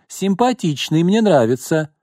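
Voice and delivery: high flirty purr. Acted. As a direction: coo approvingly. Prostitute approving.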